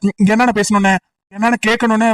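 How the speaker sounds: ordinary delivery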